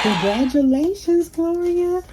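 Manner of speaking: high, bright cheer for the winner; excited congratulations. Real, not acted. Announcing the winner of the merch drawing, thrilled high cheer.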